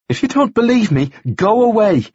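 high, wounded dismissal; upset. A narrator's reading. Woman ordering out the man who doubts her, upset. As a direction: send him off in a high, upset voice.

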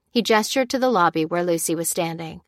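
ordinary delivery

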